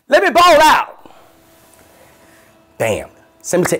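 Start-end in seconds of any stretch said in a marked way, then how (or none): 0.1-1.1 high, loud, slow boast; showy splurge. Retold or imitated. Comedian treating himself to an SUV, high loud slow boast. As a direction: announce it like a big spender.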